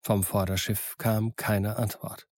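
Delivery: usual speaking voice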